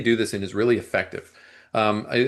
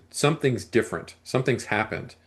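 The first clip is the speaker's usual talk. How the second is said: ordinary talk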